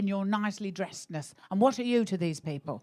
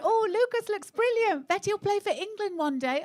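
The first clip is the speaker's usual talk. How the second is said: higher, mock-gushing praise for the kid; gushing encouragement; 9 semitones higher than usual. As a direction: ham up a supportive parent, lightly. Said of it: "Everyone else will put, like, really encouraging things".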